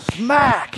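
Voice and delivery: put-on a boy hitting the ground; mock smack. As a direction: slap out the impact. Son hits the ground.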